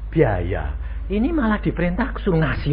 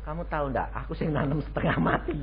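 softer, low resentful grumble; mock grumble; 4 semitones lower, 10 dB quieter, faster than usual. Resentful gardener grumbling at the neighbor, mock and soft. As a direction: grumble it under your breath while glaring. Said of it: "saudara melotot".

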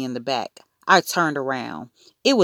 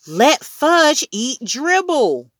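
high, slow, loud outcry; screamed accusation; 10 semitones higher, 5 dB louder, slower than usual. A narrator's reading. Boy accusing Mom of letting Fudge eat Dribble, screaming. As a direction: scream it out, stretching every word. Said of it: "I screamed".

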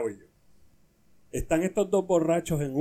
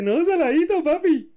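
put-on very high, loud kid voice; high-pitched child correcting his father; Pepito; 13 semitones higher, 7 dB louder than usual. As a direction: squeal it back at dad like a little boy. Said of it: "Y él le dice".

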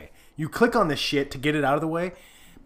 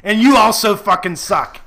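loud wrestling promo rant; 5 semitones higher, 9 dB louder than usual. Acted. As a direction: call out Google Chrome like a wrestler. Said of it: "I'm gonna cut a promo".

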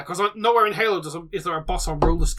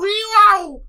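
loud, high-pitched whooped noise; high mock "wee-wow" whoop; 10 dB louder than usual. Host kicking off the right-side quarters, high silly whoop. Imitated noise to launch the round.

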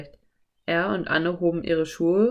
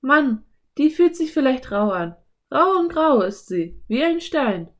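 put-on high, louder girl character voice; Anne; 7 semitones higher, 5 dB louder than usual. A narrator's reading. Anne describes the rough shell to Philipp, high lively voice. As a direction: exclaim in a raised girl's voice.

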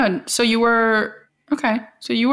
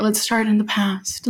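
usual speaking voice

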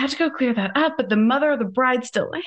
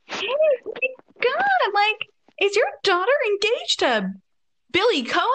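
much higher, sugary put-on friendliness; a high, fake-nice voice asking the mother; fake sweet; 10 semitones higher than usual. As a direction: sound overly bright and innocent.

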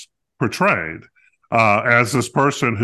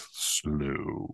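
drawn-out "slow"; 7 semitones lower, 14 dB quieter than usual. Real, not acted. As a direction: stretch "slow" out long, trailing softer. Stretching the word to mimic slowness.

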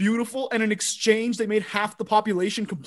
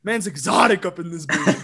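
usual speaking voice

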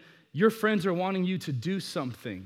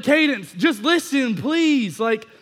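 loud, higher frantic plea; frantic pleading; 8 semitones higher, 8 dB louder than usual. Retold or imitated. Dad pleading with his daughter after her fall, frantic and loud. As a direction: beg her to listen, at full volume.

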